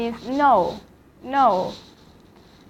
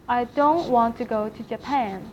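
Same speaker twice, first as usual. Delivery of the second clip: usual speaking voice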